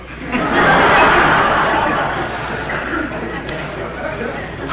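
high breathless derisive laugh; mocking laugh. Pliny laughing at the visitor, high and breathless. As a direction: cackle at him without voice.

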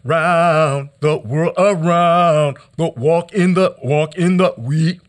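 rhythmic, insistent spoken-word chant; poetry performance. Acted. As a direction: worry each word like a drumbeat. Performed poem, repetitions and stutters.

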